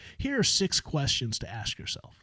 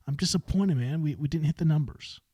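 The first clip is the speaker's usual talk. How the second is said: ordinary talk, nothing marked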